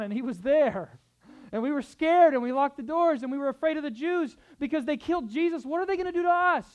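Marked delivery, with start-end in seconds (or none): 1.5-6.7 loud, high-pitched alarm, acting scared; panicked disciple. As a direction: voice the disciples' fear at a raised, urgent pitch. Acting out frightened disciples in hiding.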